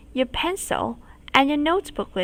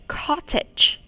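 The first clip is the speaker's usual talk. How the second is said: breathy unvoiced Cottage; whispered word; 4 semitones higher than usual. Shown as a demonstration. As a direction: whisper Cottage, then say it aloud. Teacher whispers word before repeat.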